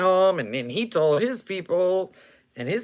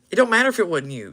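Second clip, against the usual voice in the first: ordinary talk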